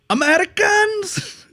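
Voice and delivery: very high drawn-out mock call; imitating shouting. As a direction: holler it like a Frenchman down the aisle. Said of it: "he just shouts out from".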